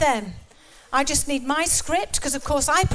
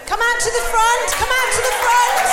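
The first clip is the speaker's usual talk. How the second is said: calling out; 6 semitones higher, 3 dB louder than usual. Real, not acted. Calling an audience member forward.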